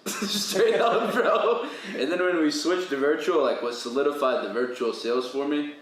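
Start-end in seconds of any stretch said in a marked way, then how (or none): 0.1-1.7 high, loud laughing affirmation; laughing agreement. Real, not acted. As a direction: laugh as you confirm it. Laughing after story.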